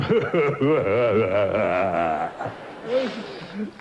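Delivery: long, low evil laugh; mock villain's laugh, sinking as it goes; villainous laugh. Host sending up his villainous golf partner, long evil laugh. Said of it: "such a villainous man".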